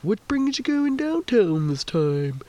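high, slow sing-song driver's question; put-on high voice of a driver making small talk; lift driver. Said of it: "the lift driver was just like".

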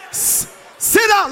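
a high, slow shout of sit down; shouted order. Real, not acted. Preacher ordering people at the back to sit down, shouting. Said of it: "Those of you at the back".

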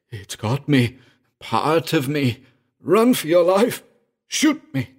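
high, strained desperate plea; groaning through clenched teeth. Acted. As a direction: groan it out loud through gritted teeth, pleading for death. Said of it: "he groaned through clenched teeth".

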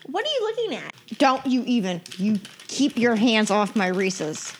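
loud sharp warning, guarding her candy. Acted.

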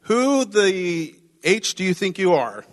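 slow, pointed "Who the H" with scorn; slow indignant retort. Retold or imitated. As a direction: drag it out like she's fed up.

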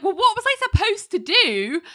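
high exasperated plea; defensive whine. Retold or imitated. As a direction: plead your case, voice jumping up. Ally defensive to boss.

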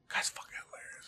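hushed whisper of awe; a real whisper, almost no voice. Real, not acted. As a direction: whisper the praise reverently. Praising late comedian.

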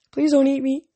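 high, small plea, not hushed; high-pitched nervous aside; nervous mutter. Acted. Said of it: "Grover muttered".

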